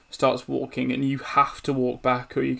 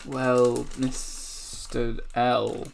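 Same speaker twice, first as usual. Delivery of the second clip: very slow, drawn-out address; slow creepy villain voice; sinister sisters; much slower than usual. Acted. Sisters take leave of Jor-El.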